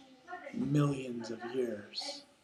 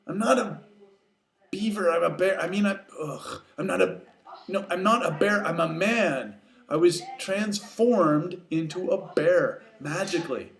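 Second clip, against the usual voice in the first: raised, insistent, stumbling protest; flustered protest; 4 semitones higher, 4 dB louder than usual. Acted. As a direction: correct yourself in exasperation. Flustered Kenai argues with moose.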